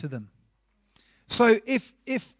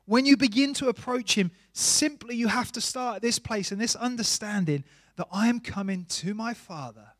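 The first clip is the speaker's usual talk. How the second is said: ordinary talk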